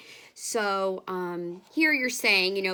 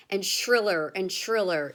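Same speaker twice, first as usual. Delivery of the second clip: ordinary talk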